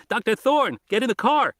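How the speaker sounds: put-on teen boy warning the doctors over the radio, high and urgent; urgent kid on the radio. A narrator's reading. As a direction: play the boy pleading to get in the car. Arby insists on radio.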